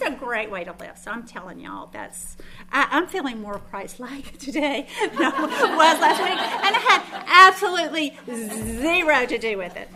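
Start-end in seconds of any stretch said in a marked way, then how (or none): 2.7-9.9 loud, laughing through the words; real laughter while confessing. Real, not acted. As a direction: joke at her own expense, cracking up.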